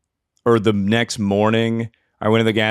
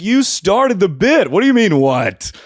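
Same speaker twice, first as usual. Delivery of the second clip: high, louder, exasperated retort; mock indignant; 11 semitones higher, 5 dB louder than usual. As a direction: play the annoyed joker who got no laugh. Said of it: "I was like".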